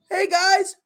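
squeaky high "Hey, guys"; left-out sidekick piping up; timid high voice. Retold or imitated.

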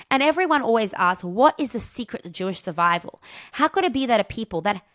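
ordinary delivery

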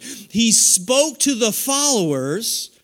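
very slow, spelled out; slowed-down teaching line; slow emphasis. Shown as a demonstration. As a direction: drag it out so they catch it. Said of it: "Come on, you catch that?".

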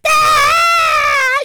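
loud, high, long scream; mock scream. Imagining being nationally famous, loud high scream.

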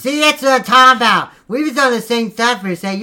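loud, high outraged rant; furious at being betrayed; indignant yell. Acted. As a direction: call him out loudly.

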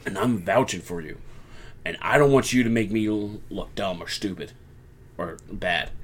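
a hint of a big man vouching for a friend; pleading wrestler. Damian Priest urging Jey to join, understated plea. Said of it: "Damian is going".

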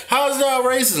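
high-pitched imitation of an offended questioner; mock challenge. Imitating people challenged on racism, in a high mock protest. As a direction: put on a high, mock-incredulous voice. Acted retort of accusers.

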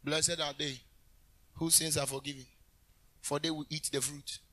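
low, soft, subdued recital; mock meek preaching. Preacher mocking a soft-spoken Jesus before thousands, low and quiet. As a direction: murmur it mildly, parodying a timid preacher. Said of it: "You think he's gonna do?".

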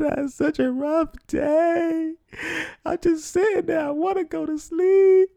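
sobbing and wailing, pitched far up; hammed-up hysterical sob story, voice cracking high; hysterical crying. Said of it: "so hysterical".

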